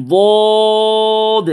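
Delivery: long, full-mouthed "বদ"; thick heavy sound. Shown as a demonstration. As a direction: intone "বদ" as a full, heavy dwooood. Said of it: "মোটা আওয়াজে".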